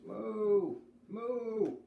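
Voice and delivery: high, loud cow moo. Acted. Narrator mooing as the bolting heifer.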